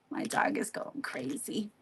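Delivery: whispered aside; whispering. Real, not acted. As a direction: whisper it to the viewers. Aside to viewers about dog.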